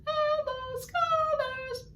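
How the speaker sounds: very high, squeaky greeting; squeaky Artie voice. Shown as a demonstration. Teacher as tiny Artie greeting students, squeaky. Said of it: "Artie probably has a very squeaky voice".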